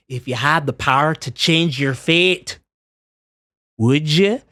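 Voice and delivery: quoting Brave in a put-on movie accent. Shown as a demonstration. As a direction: put on the film's accent, rising on the question. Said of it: "that phrase from Brave".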